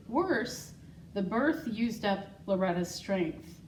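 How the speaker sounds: ordinary delivery, nothing marked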